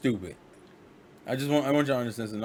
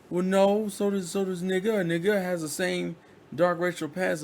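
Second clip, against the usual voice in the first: heated retort; 8 semitones higher than usual. Retold or imitated. Friend snapping back in the argument, high and insistent. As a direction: push back high and sharp.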